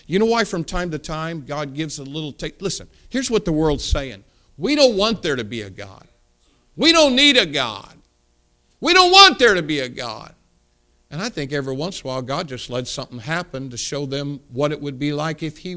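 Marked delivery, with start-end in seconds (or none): none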